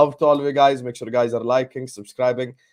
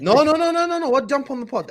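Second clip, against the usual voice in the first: excited protest; 11 semitones higher, 3 dB louder than usual. Real, not acted. Objecting to mascot joke.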